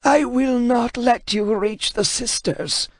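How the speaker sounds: put-on high, slow, strained old scholar voice; a frightened man refusing to yield; defiant translator. Translator defying Kratos, high and strained.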